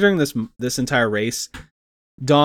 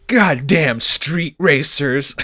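slow, drawn-out mock curse; mock grumbling driver; 2 semitones higher, 3 dB louder, much slower than usual. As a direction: imitate an exasperated delivery driver. Acting out delivery driver grumbling.

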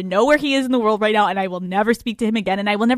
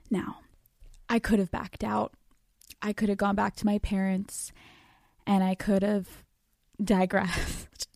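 very soft, deliberate and paused; subdued; 10 dB quieter than usual. Real, not acted. Podcaster weighing options after the lockdown news, subdued. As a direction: drop right down, weighing each option.

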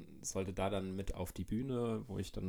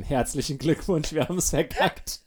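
laughing; 7 semitones higher, 8 dB louder than usual. Real, not acted. Joking podcast might fail.

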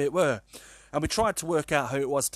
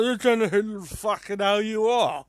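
put-on higher, louder, slurred drunken snarl; messy drunk version; 8 semitones higher, 6 dB louder than usual. Drunk lad snubbing a girl in the park, loud and slurred. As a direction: slur it out like a rat-arsed lad. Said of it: "much more messier and more drunk".

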